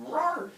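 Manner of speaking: high little "rawr"; playful growl noise. Shown as a demonstration. Teacher demonstrating brass knuckles to students, playful growl noise. Imitates gripping heavy metal knuckles.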